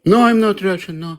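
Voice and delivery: sharp, loud no in a heated argument; loud denial. Real, not acted. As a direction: push the denial out loud and firm. Denying being Russian, heated.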